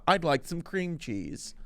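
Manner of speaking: slow odd character voice; goofy slow aside; silly put-on voice. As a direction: drop into a goofy voice for one line. Random silly voice before ad.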